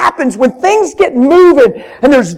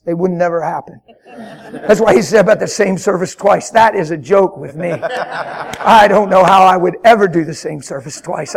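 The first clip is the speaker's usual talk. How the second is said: laughing aside; 7 semitones lower, 4 dB quieter than usual. Real, not acted. Laughing aside about never planning.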